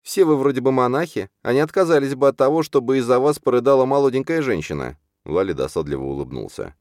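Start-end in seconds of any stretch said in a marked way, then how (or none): 0.0-5.0 high, playful mock complaint; high heroine voice, mock scolding; joking grumble. A narrator's reading. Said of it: "буркнула шутливо".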